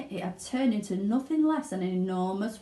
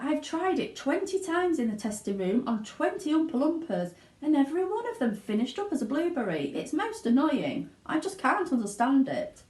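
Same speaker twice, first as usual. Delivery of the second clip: higher, fussy annoyed inventor; Mr Wonka; 7 semitones higher than usual. Acted. Wonka complains about his gum, annoyed. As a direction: grumble over the failure. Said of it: "sighed Mister Wonka".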